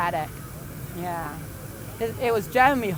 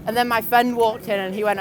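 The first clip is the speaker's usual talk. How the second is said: usual speaking voice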